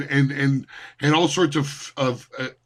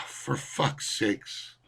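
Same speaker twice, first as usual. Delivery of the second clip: slow, breathless under-the-breath curse; exasperated mutter; 4 semitones lower, 11 dB quieter, much slower than usual. Real, not acted. Reacting to a forum comment, exasperated under the breath. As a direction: let it slip out, fed up.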